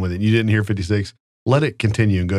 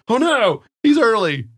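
high, mock-panicked "oh no"; mock alarm; 12 semitones higher, slower than usual. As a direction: act startled at an early guest. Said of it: "I was like".

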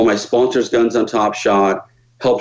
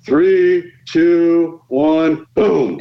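higher called-out countdown; 6 semitones higher, much slower than usual. Retold or imitated. Crew counting down the shot, called out higher. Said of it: "So I go, there they go".

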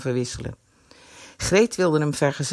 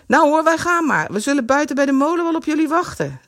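high, louder fast call; 9 semitones higher, 4 dB louder than usual. A narrator's reading. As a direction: shout it as they skate off. Friend calling out leaving.

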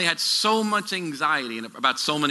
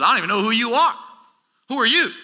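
Jesus speaking; 7 semitones higher, 4 dB louder, faster than usual. Acted. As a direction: voice Jesus higher and louder, a pleading rebuke. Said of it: "And Jesus says".

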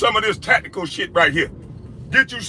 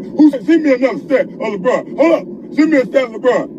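mock laughing taunt; 6 semitones higher than usual. Imitating a pushy panelist, laughing.

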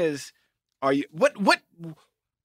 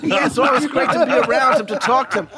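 high, louder, laughing through words; 4 semitones higher, 3 dB louder than usual. Real, not acted. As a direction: laugh while you talk. Caught out, laughs it off.

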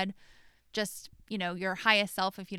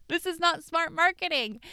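mock skeptic; 9 semitones higher, 7 dB louder than usual. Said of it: "you may be like".

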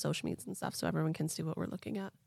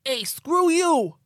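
much higher, louder, slow hammed-up shout; dad voice; 9 semitones higher, 10 dB louder, much slower than usual. Ed the abductee yelling at aliens in a hammed-up dad voice. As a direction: bellow like a cartoon dad. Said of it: "I'm just gonna do a dad voice".

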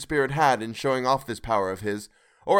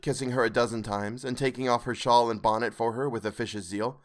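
usual speaking voice

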